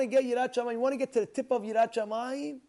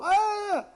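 high, loud mock scream; short yell of a man in a shouting match; imitated scream; 11 semitones higher, 5 dB louder than usual. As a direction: scream it back like an arguer. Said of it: "then the other guy screams".

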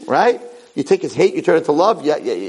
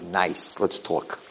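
very soft, low coaxing; hushed, soothing aside; calming; 5 semitones lower, 3 dB quieter than usual. Retold or imitated. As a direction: talk an angry kid down quietly. Calming an angry kid.

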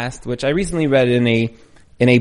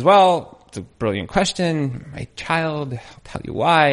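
calm and gentle; slower than usual. Acted. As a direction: soothe the troll with a patient softness. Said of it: "he was a very calm person".

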